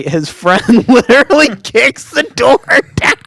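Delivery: high, squeaky voice fighting back laughter; laughing while talking. Real, not acted. Telling co-host how the friend kicked the door, laughing. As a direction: barely get the words out for laughing.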